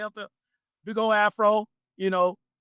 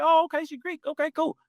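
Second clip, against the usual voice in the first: much higher, light, dismissive; friends' breezy reply. Retold or imitated. Friends shrugging off news she's Greek, high and breezy. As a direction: brush it off like it's nothing. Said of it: "it was like".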